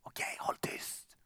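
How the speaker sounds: whispered secret instructions; hushed Jesus. Acted. Preacher voicing the hushing Jesus, whispered. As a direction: whisper it as if nobody may know. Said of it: "den där tyste".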